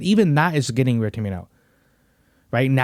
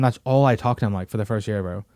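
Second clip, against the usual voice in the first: normal talking voice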